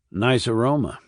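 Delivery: plain reading voice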